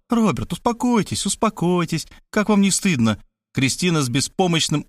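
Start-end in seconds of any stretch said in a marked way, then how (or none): 0.1-3.2 high-pitched fussing woman; compassionate lamenting. A narrator's reading. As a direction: plead with him to calm down, voice lifted. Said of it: "сердобольно причитала".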